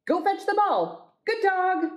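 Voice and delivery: high-pitched talking-to-a-pet voice; sing-out high praise for a pet; dog owner commanding. Acted.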